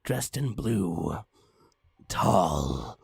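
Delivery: plain reading voice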